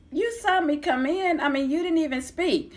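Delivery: high, offended protest; indignant disbelief. Retold or imitated. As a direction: call him out, hurt he ignored you. Confronting Brian in court.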